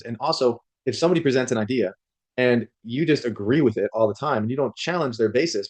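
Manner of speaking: ordinary talk, nothing marked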